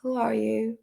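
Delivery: usual speaking voice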